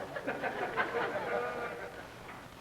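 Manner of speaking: soft breathy laugh. Acted. Laughing at wife's smile.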